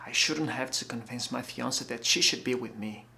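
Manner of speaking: subdued and hurting, with long pauses; tender plea. Acted. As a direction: plead quietly with the one you love. Pleading love to straying fiancée.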